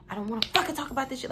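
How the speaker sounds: high, fast angry outburst; retold angry cussing. Cussing out roommate, fast high angry burst. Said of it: "I'm cussing".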